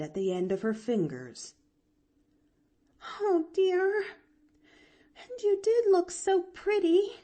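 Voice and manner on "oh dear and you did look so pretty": put-on very high, tearful girl voice; almost sobbing. A narrator's reading. Girl leaving her aunt's room, almost sobbing. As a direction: lament it on the edge of sobs. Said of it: "almost sobbed Pollyanna".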